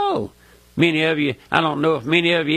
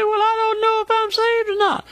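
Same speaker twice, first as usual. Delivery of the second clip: high, put-on voice of an unsure listener; doubtful listener. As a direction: squeak it out like a worried doubter. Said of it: "You say".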